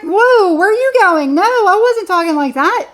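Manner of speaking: whoa, hold on, I didn't mean that; startled protest. Retold or imitated. Reacting as someone misreads the speaker's words, startled protest.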